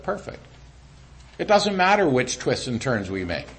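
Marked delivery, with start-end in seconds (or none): 0.0-0.4 hushed, gentle "That's perfect"; soft approving God. Acted. Preacher voicing God's calm approval, hushed. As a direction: let God say it almost under the breath. God's reply voiced softly.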